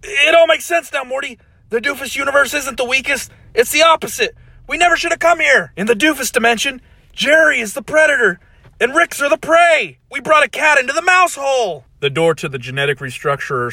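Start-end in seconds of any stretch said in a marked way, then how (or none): 0.0-11.8 high, loud panicked warning; terrified. Acted. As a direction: blurt it out in rising dread. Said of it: "Original Rick looks terrified".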